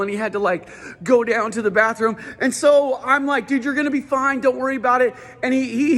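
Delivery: high, pumped-up stand-up delivery; stand-up routine. Acted. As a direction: sell every bit to the crowd, high and hyped. Performs his stand-up routine.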